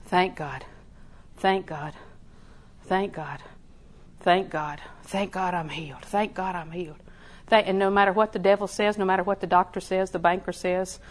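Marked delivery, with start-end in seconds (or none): 0.1-7.0 soft, low, steady thanksgiving; hushed prayerful repetition; quiet repeated thanks. Shown as a demonstration. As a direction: murmur thanks over and over. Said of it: "go back and say".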